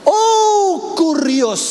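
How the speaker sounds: high, loud cry of Kurios; exclaiming Kurios. Acted. Preacher to congregation, crying out Kurios high. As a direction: cry out the name in awe. Stephen's prayer voiced.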